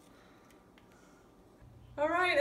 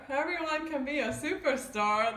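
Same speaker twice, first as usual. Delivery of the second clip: normal talking voice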